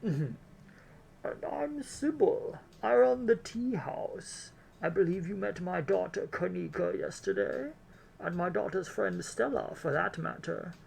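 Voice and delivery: drawn-out elderly lady, put on thick; grandma voice. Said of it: "my grandma voice, which is just my Bane voice".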